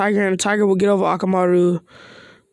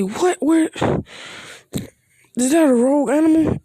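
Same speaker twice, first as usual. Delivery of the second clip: higher alarmed questions; startled crowd; 6 semitones higher than usual. Retold or imitated. Onlookers seeing a tiger burst in, mock alarmed questions. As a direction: jump in surprise at the beast.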